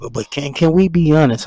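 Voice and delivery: ordinary delivery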